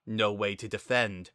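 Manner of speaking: plain reading voice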